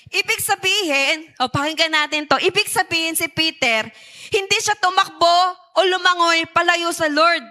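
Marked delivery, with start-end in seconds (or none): none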